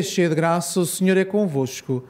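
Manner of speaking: ordinary delivery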